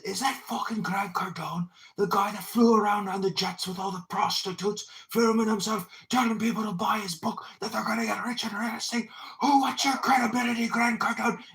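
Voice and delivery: put-on English accent. Roasting Grant Cardone in an X space, in a mock English accent. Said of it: "I was doing an English accent".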